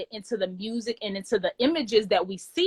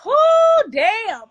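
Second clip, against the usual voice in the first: high, loud burst of relief; oh then damn, a loud high release of relief; excited exclaim; 12 semitones higher, 14 dB louder than usual. Real, not acted. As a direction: let it burst out, breathless and thrilled. Reaction after finishing poem.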